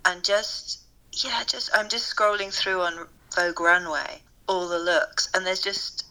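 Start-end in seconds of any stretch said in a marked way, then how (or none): none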